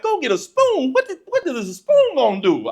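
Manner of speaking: high-pitched puzzled echo; baffled curiosity. Retold or imitated. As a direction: repeat it like a bewildered kid. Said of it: "Now I'm really curious".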